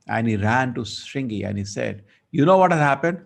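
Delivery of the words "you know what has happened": breathless, high and loud announcing; a boy blurting out the news; excited news. Acted. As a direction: rush in with urgent news.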